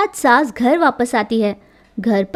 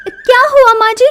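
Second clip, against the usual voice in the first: higher, louder, nervous questioning; alarmed daughter-in-law; 6 semitones higher, 5 dB louder, faster than usual. A narrator's reading. Daughter-in-law seeing mother-in-law with stick, alarmed. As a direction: ask warily about the stick.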